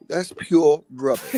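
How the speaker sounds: slow, haughty Brit "pure rubbish"; mock British accent. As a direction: sneer it like a posh Englishman. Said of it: "That is rubbish".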